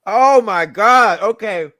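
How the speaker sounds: loud, slow, stunned exclamation; drawn-out shocked gasp of words. Real, not acted. Reacts to tasting Spam.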